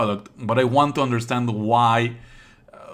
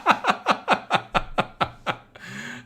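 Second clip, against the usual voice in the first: high, unvoiced burst of laughter. Real, not acted. Streamer bursting out laughing at the game character. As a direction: crack up at the screen.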